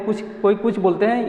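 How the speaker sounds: normal talking voice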